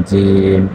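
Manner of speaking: জিম said as a clear model sound; jim demo. Shown as a demonstration. Teacher asking students to listen to জিম, carefully. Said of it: "আমি উচ্চারণ করি, তা একটু খেয়াল করবেন".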